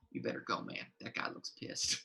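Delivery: whispered side warning. Acted. Red Ink whispering a warning to a player. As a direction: lean in and whisper it.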